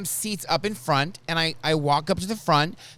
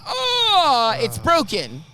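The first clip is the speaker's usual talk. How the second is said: pained cry; 9 semitones higher, 5 dB louder, much slower than usual. Retold or imitated. Toe stepped on.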